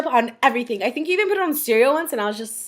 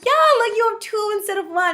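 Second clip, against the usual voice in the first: cheery excuse; 7 semitones higher than usual. Retold or imitated. Kid cheerfully defending the trade.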